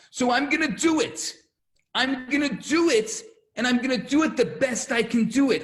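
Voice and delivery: high, loud fervent plea; impassioned resolve. Retold or imitated. Moses to God about the job, impassioned resolve. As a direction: push each phrase out with conviction.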